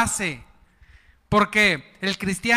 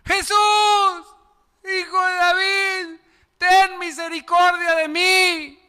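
much higher, desperate pleading cry; blind beggar crying out; 9 semitones higher than usual. Acted. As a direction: cry out like a blind man begging for mercy.

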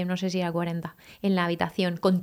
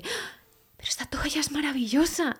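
breathy whispered gush, higher; lightly voiced man in awe of a soft towel; mock delighted guest; 7 semitones higher, 4 dB quieter than usual. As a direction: imitate a guest swooning over a towel. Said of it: "con mi toalla dice".

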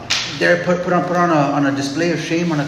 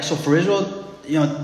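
ordinary talk, nothing marked

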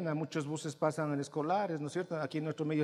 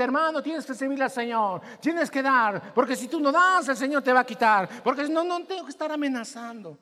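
mock threatening preacher, loud; imitating a threatening pastor demanding offerings; 8 semitones higher, 9 dB louder than usual. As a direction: play a pushy preacher scolding. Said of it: "andando amenazando a la gente".

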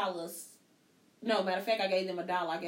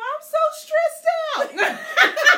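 slow, much higher and louder, dissolving into laughter; mocking whine, laughing; 12 dB louder, much slower than usual. Mother mocking her ten-year-old's tears, laughing through it. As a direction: drag it out like a sobbing kid while cracking up. Mimicking crying daughter, laughing.

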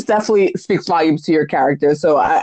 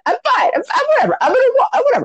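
much higher-pitched mimicry of the guest; mock nonchalance; 12 semitones higher, much faster than usual. Host mimicking guest's robbery story, mock nonchalant. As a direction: brush it off in a high, put-on voice. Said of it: "as you said".

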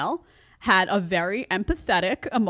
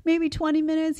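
retold pleading wife; 9 semitones higher than usual. Mom asks husband to give in to kid, high coaxing. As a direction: coax him gently, pitched up. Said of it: "And I'm like".

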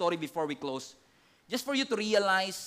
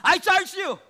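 loud, high commanding charge; a stern high priest charging Jesus; 10 semitones higher, 9 dB louder, slower than usual. Acted. High priest voiced again.

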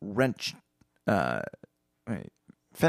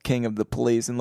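ordinary talk

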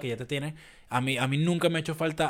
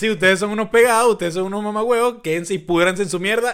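louder, higher scornful tirade; mock angry rant; 6 semitones higher, 7 dB louder than usual. Voicing the coworker cursing everyone out, scornful and loud. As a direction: spit it at the whole office. Acting out coworker's farewell insult.

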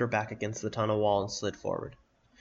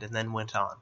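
ordinary delivery, nothing marked

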